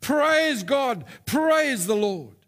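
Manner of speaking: high, drawn-out shout of praise; acted praise shout. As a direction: shout skyward as the old lady.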